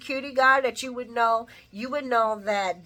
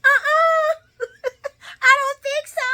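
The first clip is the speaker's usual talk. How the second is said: high-pitched, loud squeal breaking into laughter; mock a voice shooting way up; Steven; 7 dB louder than usual. Vlogger laughing as they mimic the high-pitched denial. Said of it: "Steven's voice got high-pitched".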